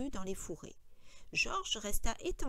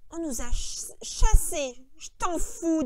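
louder, scornful echo; disgusted; 6 semitones higher, 10 dB louder, slower than usual. A narrator's reading. George throwing Lenny's words back at him, disgusted. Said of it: "dit Georges avec dégoût".

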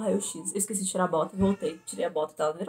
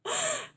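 high laugh. Real, not acted. Laughing at her own panic.

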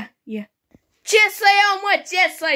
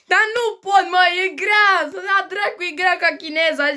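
mock whining; 6 semitones higher than usual. Child whines lesson too hard.

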